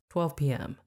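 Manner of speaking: usual speaking voice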